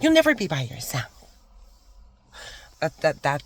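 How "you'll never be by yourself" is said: hammed-up bubbly coworker imitation; mocking office manager. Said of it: "that's how she was".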